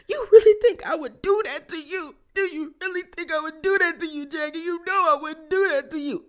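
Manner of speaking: high, whiny mock sobbing voice; imitating a crocodile-tears plea; fake crying. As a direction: ham up a fake cry. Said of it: "This how easy you could fake cry".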